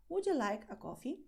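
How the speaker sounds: ordinary talk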